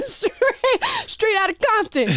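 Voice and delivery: amused, laughter breaking in; laughing. Real, not acted. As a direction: say it through giggles. Joking reply while laughing.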